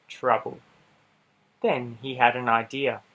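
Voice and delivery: usual speaking voice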